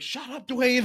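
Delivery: high mock yell at Dwayne. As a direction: shout him down, half joking. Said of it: "They're all yelling".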